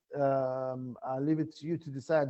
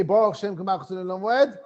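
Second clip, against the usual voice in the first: loud and higher, declared with force; 5 semitones higher, 8 dB louder than usual. Shown as a demonstration. Teacher showing students how Baruch Shem is said on Yom Kippur, loud and forceful. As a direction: proclaim it loudly, as on Yom Kippur.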